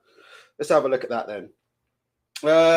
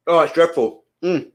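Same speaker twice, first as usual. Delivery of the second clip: through a balaclava; 2 semitones lower than usual. Real, not acted. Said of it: "eating it through a balaclava".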